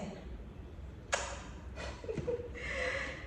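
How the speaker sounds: high breathy giggle; high soft giggle. Real, not acted. Laughs over the bag gift.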